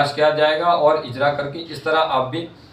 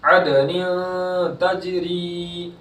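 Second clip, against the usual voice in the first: slow, drawn-out Quranic chanting; tajweed recitation; 3 semitones higher, much slower than usual. Shown as a demonstration. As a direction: stretch the vowels in recitation. Right recitation.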